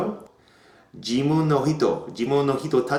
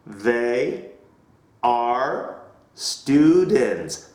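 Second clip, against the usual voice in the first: slow model answer; much slower than usual. Shown as a demonstration.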